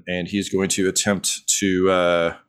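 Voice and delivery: usual speaking voice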